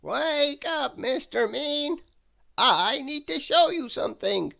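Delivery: high, slow, calling wizard voice; wizard's voice. Acted. Wizard waking Mister Mean, high calling voice. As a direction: summon the sleeper from his bed. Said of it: "said a voice".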